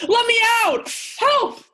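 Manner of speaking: high, loud panicked shouts for help; hammed-up trapped captive crying out for rescue; calling for help. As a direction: yell to be let out of the cage, half laughing. Locked in a cage, calling help.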